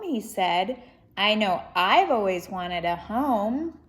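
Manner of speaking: lively, expressive storybook reading; reading to children. Acted. Reading Corduroy aloud to children, lively storybook voice. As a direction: read it out to a young audience with energy. Reads picture book aloud.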